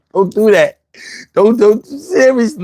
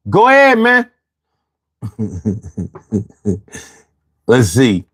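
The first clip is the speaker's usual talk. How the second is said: usual speaking voice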